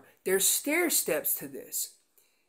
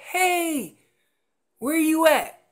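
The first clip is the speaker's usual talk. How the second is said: calling out; 7 semitones higher, 6 dB louder, slower than usual. Retold or imitated. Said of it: "a location bugle is just saying".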